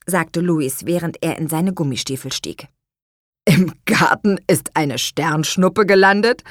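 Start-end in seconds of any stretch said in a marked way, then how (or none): 3.4-6.4 a lightly voiced baffled father asking; astonished question. Father hears a star landed in the garden, mildly astonished. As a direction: ask it in mild disbelief. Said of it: "fragte Herr Kuchenbrand verblüfft".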